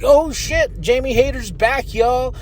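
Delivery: high-pitched excited fan reaction. Retold or imitated. Host reliving Jamie Hayter coming out, excited. Acting out reaction to wrestler's return.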